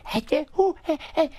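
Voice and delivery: high-pitched babbling goofy noises; silly high gibberish babble; Sandler gibberish noise. Acted. Guest demonstrates one phase of his Sandler impression, goofy gibberish. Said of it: "then he does the thing where".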